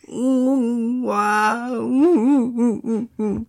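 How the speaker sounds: high whiny mock sobbing; mock puppy whimpering. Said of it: "哭得好凄惨".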